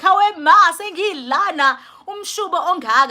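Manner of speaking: high, loud mock lament; wailing newborn, pretend. Newborn complains to mother about life ahead, mock high wail.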